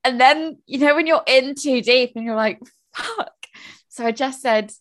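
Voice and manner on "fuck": a high, breathy-soft "Fuck" under the breath; dawning panic, barely voiced; hushed dismayed curse. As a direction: let it slip out, high and much softer. Realising she's in too deep.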